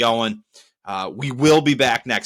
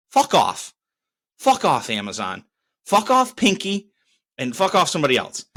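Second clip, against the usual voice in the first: angry tirade; 3 semitones higher than usual. Real, not acted. Angry outburst at Amazon.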